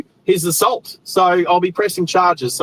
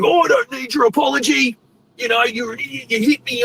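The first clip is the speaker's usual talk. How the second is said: higher-pitched imitation of an angry driver; mock irate retort; 6 semitones higher than usual. Acted quarrel with the irate driver.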